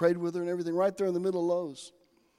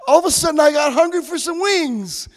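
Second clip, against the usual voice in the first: comic craving; 10 semitones higher, 10 dB louder than usual. Retold or imitated.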